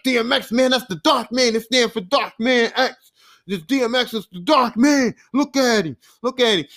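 excited retelling, pitched high; hyped fan. Retold or imitated. Friend shows off the album cover to his buddy, hyped. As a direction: imitate a friend gushing over his new favorite rapper. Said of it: "He was hyped".

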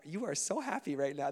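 ordinary talk, nothing marked

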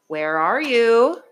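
searching and hoping he hears; worried call. A narrator's reading. Father calls into the dark for his lost son, slow worried call. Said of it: "he called".

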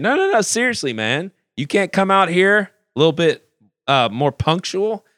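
mocking, high-pitched needling of the keyboardist; asshole tone. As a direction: jeer at him in front of everyone. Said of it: "he's got an asshole tone".

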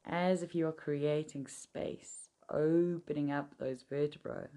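soothing massage murmur. Acted. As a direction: guide them gently into calm. Leads a seated meditation.

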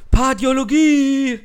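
hoch, laut und langsam gerufen; rufend. Pretend. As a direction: lockend herausrufen wie im Film. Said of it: "fährt einer vor und schreit". (langgezogener Ruf)

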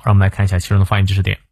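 ordinary talk

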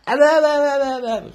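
high, loud, stretched evers; loud emphatic repeats. Real, not acted. A fan warning players off the Knicks, loud and high.

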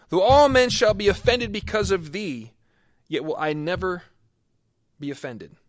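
loud, high, proud declaration; Peter's bold vow. Acted. Peter boasting to Jesus he will never fall away, loud. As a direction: swear it boldly as Peter. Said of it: "Peter answered and said unto him".

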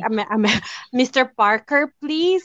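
stumbling put-on American accent; try-hard American accent. Shown as a demonstration. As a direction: fake an American telemarketer, trying hard. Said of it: "try hard ko, American accent".

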